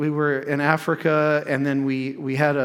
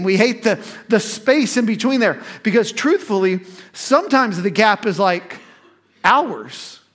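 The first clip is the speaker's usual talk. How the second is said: higher, louder sermon delivery; impassioned preaching; 5 semitones higher, 4 dB louder than usual. Real, not acted. Preacher telling congregation we hate waiting in the gap, impassioned preaching. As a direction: drive each point home to the congregation.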